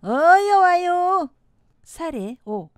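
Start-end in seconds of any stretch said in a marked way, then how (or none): 0.0-1.3 loud, drawn-out Chungcheong dialect; 충청도 사투리. Shown as a demonstration. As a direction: call them over slowly, Chungcheong style. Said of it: "충청도". (크고 길게 끄는 충청도 사투리)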